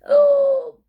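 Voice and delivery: loud high gasp; gasp bursting out. A narrator's reading. Skeleton bursting after holding his breath, loud high gasp.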